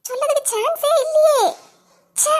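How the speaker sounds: high, half laughing half crying; high crying laugh, alone after the fight; laughing sob. Acted. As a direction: laugh through tears at the unfairness.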